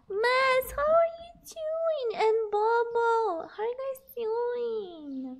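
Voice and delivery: high, drawn-out delighted hello; gushing greeting. Real, not acted. Streamer greeting arriving viewers, gushing. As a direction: sing out the greeting, stretching the last words.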